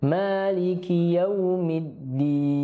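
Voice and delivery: very slow chanted verse; Quran recitation. Shown as a demonstration. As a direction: intone the whole verse slowly.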